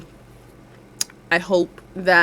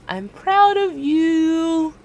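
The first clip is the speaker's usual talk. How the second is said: higher, drawn-out I'm proud of you; high slow affirmation; 9 semitones higher, much slower than usual. Shown as a demonstration. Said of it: "tell yourself once again".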